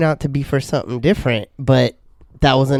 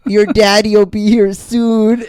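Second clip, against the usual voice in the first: high, loud mock-comforting voice; high loud mock consoling; mock soothing office lady; 8 semitones higher, 4 dB louder, slower than usual. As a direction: imitate her consoling a baby. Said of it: "she goes".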